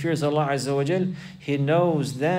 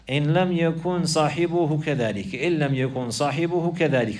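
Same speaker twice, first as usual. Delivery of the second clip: hadith recitation; faster than usual. Acted. Teacher recites the hadith to his class, brisk and measured. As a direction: recite the narration briskly and clearly.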